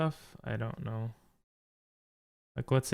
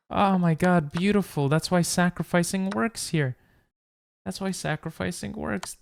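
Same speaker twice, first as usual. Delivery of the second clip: excited; 6 semitones higher than usual. Real, not acted. Finds winning sacrifice line.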